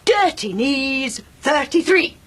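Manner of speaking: bingo caller. Acted. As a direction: sing out the bingo rhyme. [name] answering bingo call.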